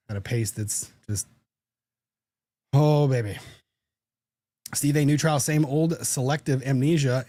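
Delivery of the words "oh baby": exasperated exclamation. Pretend. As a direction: groan it out in comic despair. Comic exasperation.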